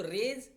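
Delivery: रेज said with a hard push; रेज punched out firmly; forceful raise. Shown as a demonstration. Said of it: "रेज बोलने में आपको क्या एक अलग सा फोर्स लगाना पड़ रहा है".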